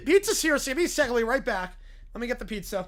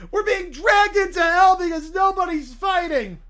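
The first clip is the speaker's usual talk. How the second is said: panicked shout; 3 semitones higher, 6 dB louder than usual. Acted. Kids panic, shouting explanation.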